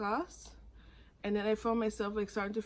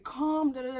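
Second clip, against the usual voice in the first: higher, louder urgent call; retold urgent plea, raised voice; urgent demand; 6 semitones higher, 5 dB louder than usual. Arguing with the ambulance service, urgent demand.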